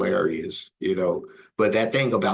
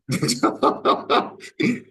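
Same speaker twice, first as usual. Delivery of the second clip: loud, high burst of laughter; hearty laugh; 9 semitones higher, 5 dB louder than usual. Real, not acted. Man amused after his guilty-ears saying, hearty laugh. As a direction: laugh hard in quick pulses.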